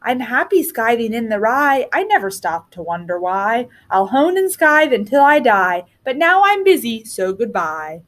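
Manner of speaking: ordinary delivery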